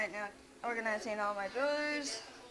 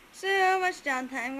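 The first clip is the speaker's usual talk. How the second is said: higher, louder and slow mocking echo; sarcastic; 8 semitones higher, 9 dB louder, slower than usual. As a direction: drag out "So much downtime" scornfully. Mock echo of idle workers.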